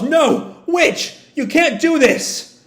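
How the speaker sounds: very high, anguished call; high-pitched desperate protest; calling out desperately. Acted. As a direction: cry out to stop a friend. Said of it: "Shiki calls".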